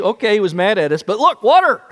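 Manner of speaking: high, loud, gleeful surprise; delighted people. Retold or imitated. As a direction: burst out with joy at the water. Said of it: "they see".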